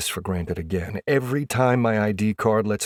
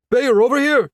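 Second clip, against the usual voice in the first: high, loud call for attention; yelling; 9 dB louder than usual. A narrator's reading. Photographer to the player at practice, high yell. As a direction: yell to catch someone's eye across the rink. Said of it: "a photographer yells".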